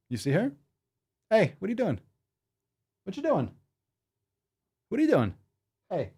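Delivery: high, soft voice for the dog; dog talk. Real, not acted. Host greeting his dog, high baby talk. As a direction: coo at the pup.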